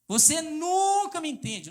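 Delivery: high, loud, slow reproach; mock bitter complaint. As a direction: whine it out slowly and loud. Acting out contemptuous couple speech.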